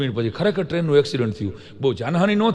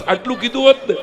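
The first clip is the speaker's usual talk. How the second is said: tearful complaint; 8 semitones higher than usual. Retold or imitated. Crying man complaining to God.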